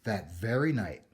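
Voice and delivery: usual speaking voice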